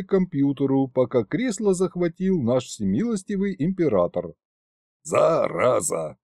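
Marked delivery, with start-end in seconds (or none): 5.0-6.2 slow, low self-pitying Зараза; self-pitying curse. Acted. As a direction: mutter Зараза feeling sorry for yourself. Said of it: "повторил Кощей, посочувствовав самому себе".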